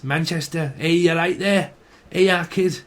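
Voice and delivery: perky put-on Mancunian greeting; mock Mancunian accent; Manchester accent. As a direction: hail a sibling in broad Manchester. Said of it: "from Manchester or Leeds".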